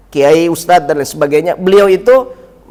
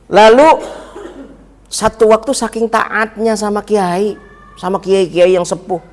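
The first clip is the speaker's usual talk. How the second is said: ordinary delivery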